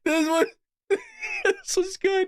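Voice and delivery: high, breaking up mid-sentence; helpless giggly squeal; real laughter. Real, not acted. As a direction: laugh so hard the words barely get out. Cracking up at the clip.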